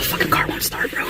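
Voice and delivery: hushed, voiceless whisper. Real, not acted. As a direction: whisper it under your breath. Car stalls on dark road.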